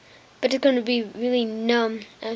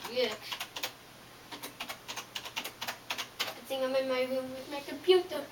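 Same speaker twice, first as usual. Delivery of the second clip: away from mic; 15 dB quieter than usual. Real, not acted. Walks away from the camera.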